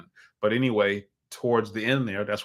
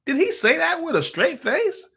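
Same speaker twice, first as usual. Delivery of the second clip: high-pitched, astonished question; incredulous; 4 dB louder than usual. Retold or imitated. Husband asking his wife about the pimp's claim, incredulous. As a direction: voice shoots up in retold disbelief.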